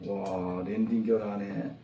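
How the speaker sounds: soft, low, pleased murmur; quiet self-praise. Real, not acted. Teacher quietly pleased with herself in class. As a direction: mutter happily to yourself. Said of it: "기분 너무 좋네".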